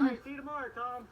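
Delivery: loud shout across the lot; calling out. Real, not acted. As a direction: call out a goodbye from afar. Calling after friend leaving.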